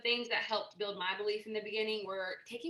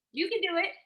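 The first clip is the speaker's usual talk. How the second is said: bright, high cheer of belief; encouraging; 9 semitones higher, 6 dB louder than usual. Real, not acted. A coach urging nervous sellers on, in an eager high cheer. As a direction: lift them up with a rising push.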